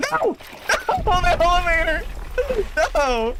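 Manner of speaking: high, loud laughing wail; wailing in dismay. Real, not acted. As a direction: cry out helplessly at your own blunder. Laughing wail at own blunder.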